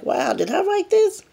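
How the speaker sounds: high-pitched retold amazement; amazed self-quote. Writer finding her forgotten notes, high amazed self-quote. Amazed reaction to old writing.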